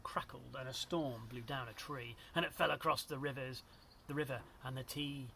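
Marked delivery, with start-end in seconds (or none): none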